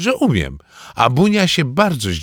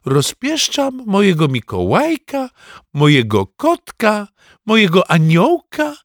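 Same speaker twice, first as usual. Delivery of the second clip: high, gushing granny voice; doting, high-pitched baby talk; cooing grandmother; 10 semitones higher, 3 dB louder than usual. Acted. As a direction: coo over your darling, getting louder. Grandmother coos over spoiled grandson.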